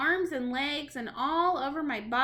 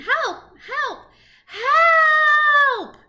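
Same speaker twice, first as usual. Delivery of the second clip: high screams building to a long loud shout; screaming for help; 13 semitones higher, 12 dB louder, much slower than usual. A narrator's reading. Boy calling toward the doors, rising screams. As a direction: cry out louder each time. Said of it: "I screamed".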